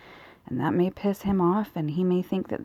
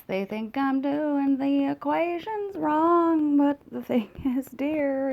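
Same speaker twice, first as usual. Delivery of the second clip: high, loud, slow theatrical singsong; mock grand voice; 6 semitones higher, 5 dB louder, slower than usual. Mocking her own made-up math in a hammed-up, high, slow voice. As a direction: ham it up like a pompous diva.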